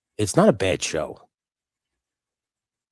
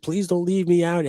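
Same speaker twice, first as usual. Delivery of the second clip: put-on pleading reindeer boy; 10 semitones higher than usual. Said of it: "He's like".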